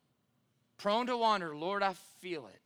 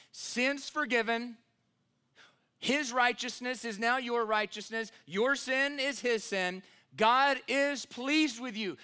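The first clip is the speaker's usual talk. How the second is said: raised, higher-pitched preacher's proclamation; rousing pulpit declaration to a congregation; full-force preaching; 6 semitones higher, 4 dB louder than usual. Real, not acted. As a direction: proclaim the good news loudly, drawing out key words.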